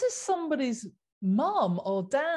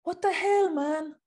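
Italian style; 9 semitones higher than usual. Pretend. Mocking Italian brands that refuse vegan leather, in a hammed-up Italian accent. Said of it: "Italian style".

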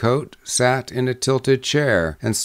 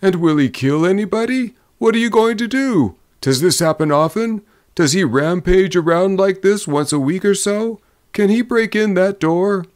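high, agitated string of questions; anxious drummer; 9 semitones higher, 3 dB louder than usual. A narrator's reading. Drummer asking the men about Scratchy, anxious. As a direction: fire worried questions in a raised pitch.

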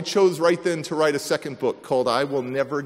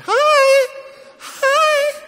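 put-on very high, loud tiny child's hi; little girl greeting; 10 dB louder than usual. The healed three-year-old greeting him at the door, very high child voice.

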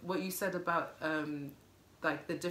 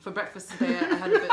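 put-on British accent; 7 semitones higher, 7 dB louder than usual. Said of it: "About Buckingham Palace and bullshit".

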